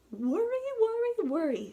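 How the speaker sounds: high, fussing granny; tut-tut like an old granny; grandmother's voice. A narrator's reading. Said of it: "said her grandmother".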